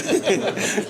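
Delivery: high bright burst of laughter; delighted laugh. Real, not acted. Telling the host, a delighted laugh.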